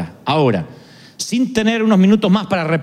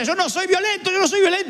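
higher, strained mock protest; imitated violent denial; 9 semitones higher than usual. A hint of an angry man swearing he isn't violent. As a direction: act out a man insisting he's not violent. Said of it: "y estaba rompiendo el escritorio".

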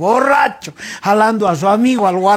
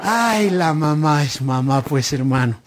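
wistful sigh; 7 semitones lower, 3 dB quieter than usual. Acted. Sighing repeat of the saying.

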